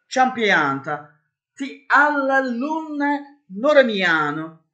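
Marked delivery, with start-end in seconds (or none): 1.6-4.6 very high, slow mock Italian crooning; hammed-up cod Italian, high and drawn out; fake Italian sing-song. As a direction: croon nonsense Italian like an opera parody.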